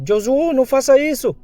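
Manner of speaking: very high-pitched pleading yell; shouting. Acted. Girl yelling at Josuo to stop, desperate shout. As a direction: cry out to stop him, voice way up. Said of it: "A gasosa logo grita".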